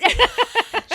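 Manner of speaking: loud high cackle; burst of laughter. Real, not acted. Laughing at her own story. Laughing about friend's advice.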